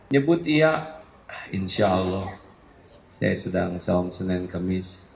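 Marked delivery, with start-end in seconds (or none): 1.3-5.1 soft, lowered mock-modest voice; fake-humble, a little quieter and lower; humble-brag reply. To a nosy friend, mock-modest soft humble-brag. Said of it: "Hilang lima tahun tuh".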